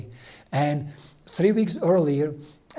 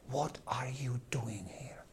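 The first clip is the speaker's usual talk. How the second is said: hushed, breathless whisper to God; whispered prayer; 3 semitones lower, 16 dB quieter, slower than usual. Retold or imitated. A priest whispering his frustration to God in prayer. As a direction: whisper it like a desperate secret prayer.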